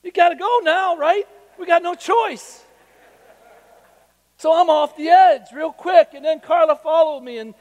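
high, loud, breathless storytelling; preacher reliving a terrifying sixty-foot jump, high and loud; excited retelling. Real, not acted. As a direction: tell it like you're reliving the jump.

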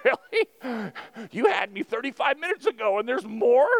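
high, loud, incredulous TV viewer; mock amazed viewer. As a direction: ham up disbelief at an infomercial. Mocking a TV commercial viewer.

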